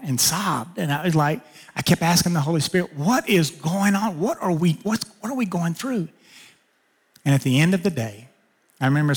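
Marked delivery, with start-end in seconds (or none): 3.0-6.1 pleading question. Retold or imitated. Asking the Holy Spirit while weeping.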